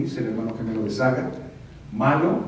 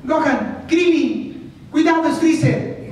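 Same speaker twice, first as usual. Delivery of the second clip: put-on much higher, loud, urgent boy warning; young Goku voice; 6 dB louder, much slower than usual. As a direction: shout a warning like a young hero. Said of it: "muy, muy adolescente".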